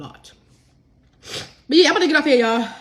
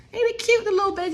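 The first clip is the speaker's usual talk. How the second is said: cooing; 11 semitones higher, much faster than usual. Real, not acted. Cooing over her cookbook.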